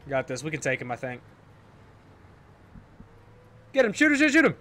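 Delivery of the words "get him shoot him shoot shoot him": high, loud rapid-fire urging; urgent command. Real, not acted. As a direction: urge the team to fire now.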